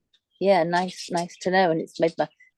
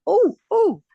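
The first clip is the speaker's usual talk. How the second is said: a much higher, louder delighted "Oh, oh"; excited oh oh; 7 dB louder than usual. Retold or imitated. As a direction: burst out in sudden delight. Acting out her own delight.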